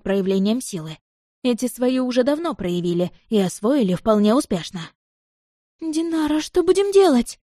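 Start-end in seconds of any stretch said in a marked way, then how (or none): 5.8-7.4 high, frightened whisper; scared, pleading voice; whispered fear. Acted. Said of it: "испуганно прошептала Ария".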